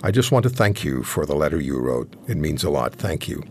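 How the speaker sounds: low, soft, gracious imitation of a kind man; very kindly. Hockey star thanking a young fan by phone, very kindly. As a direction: quote him gently, dropping low and quiet. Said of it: "he said very kindly".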